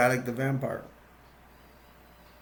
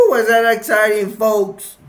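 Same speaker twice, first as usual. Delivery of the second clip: loud, high shout to the audience; excited hype; 6 semitones higher, 8 dB louder than usual. Real, not acted. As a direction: whoop up the crowd. Hyping the audience after climax.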